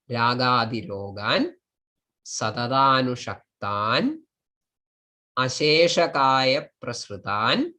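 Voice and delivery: each word from "Ragadirogan" to "tasmai" alone, rising, with pauses; list-like lift on each word, falling low on "tasmai"; word-by-word split. Shown as a demonstration. Teacher reading the block split into words, separated by pauses. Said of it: "split into all possible words".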